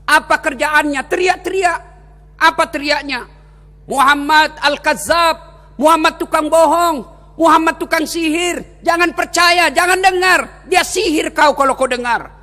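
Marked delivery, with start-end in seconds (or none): 3.9-12.3 a hint of jeering street shouting; mock shouted insults. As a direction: call out insults across the market. Blind man shouting insults voiced.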